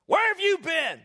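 high, loud mock demand; accusing greeting. Shown as a demonstration. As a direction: call out an unwelcoming usher's challenge. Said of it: "this is what I don't want ushers to do".

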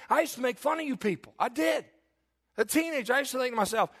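high, loud preaching that keeps climbing; impassioned preaching. Acted. Pastor confesses his teenage past to the church, impassioned preaching. Testimony preached at full force.

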